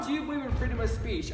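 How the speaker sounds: normal talking voice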